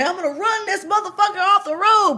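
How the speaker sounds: high, loud threat yelled from the car; mock yell. Driver reenacting the scripted threat, yelled high and loud. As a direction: shout it like you mean to ram him. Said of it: "I was supposed to say".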